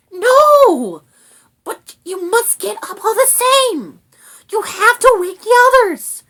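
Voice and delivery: very high, loud, frantic rat; frantic little creature; urgent rat. A narrator's reading. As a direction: urge a sleeper up in a squeaky shout. Rat urgently rouses the dragon.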